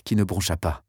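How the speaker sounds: usual speaking voice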